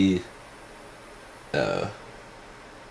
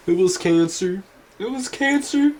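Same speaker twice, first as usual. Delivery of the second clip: much higher, wailing mourner voice; mock grieving fans. Fans cradling over him, high mock wailing. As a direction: ham up a weepy fan in a falsetto. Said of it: "everyone's, like, cradling over him".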